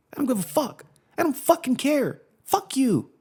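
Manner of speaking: high, far-off mock movie-star tirade, played for laughs. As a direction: ham up a swearing actress rattling off one line. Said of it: "And it's all one line".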